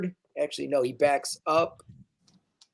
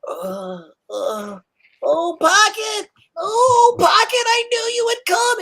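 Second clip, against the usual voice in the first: very high, louder joyful gnome cry; a tiny tinkerer overwhelmed with relief; high, slow gleeful exclamation; overjoyed little gnome; 6 dB louder than usual. Acted. Gnome voiced in GM story.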